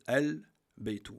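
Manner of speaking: "al-baytu" slow, with the L of al sounded clearly; lam pronounced. Shown as a demonstration. Teacher showing students that the written L is heard, sounded clearly. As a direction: say "al-baytu" stretched, L fully voiced. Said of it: "à la prononciation".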